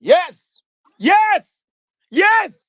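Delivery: high, loud repeated yes; a loud crowing yes; triumphant yes. Real, not acted. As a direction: shout it out in triumph. Triumphant outburst in heated debate.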